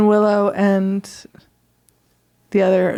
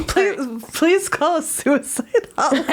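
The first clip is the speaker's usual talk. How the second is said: higher, giggling plea; laughing; 6 semitones higher than usual. Real, not acted. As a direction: say it while laughing. Joking between laughs.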